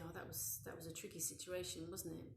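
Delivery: softly spoken, offhand comfort; casually validate the child, keeping it soft; casual validation. Acted. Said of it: "very casually val-, validate it".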